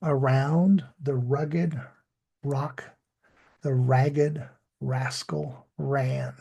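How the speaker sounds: slow, measured "Around the rugged rock, the ragged rascal ran" with careful English R; deliberate practice reading, full R starting "rugged", "rock", "ragged", "rascal", "ran"; tongue twister practice. Shown as a demonstration. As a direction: model "rugged rock" and "ragged rascal ran" unhurriedly, pausing between, each R clear. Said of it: "practice saying that tongue twister".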